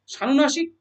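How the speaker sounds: normal talking voice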